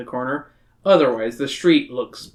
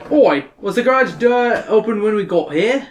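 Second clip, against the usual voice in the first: sarcastic Australian bloke; Australian accent; 6 semitones higher, 4 dB louder than usual. Acted. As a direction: grumble it in a broad Australian accent. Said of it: "great Australian accent like I can".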